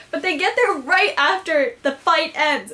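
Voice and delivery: ordinary talk, nothing marked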